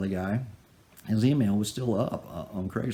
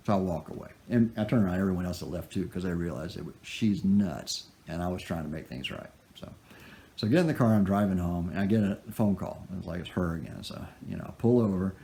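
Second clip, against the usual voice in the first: ordinary talk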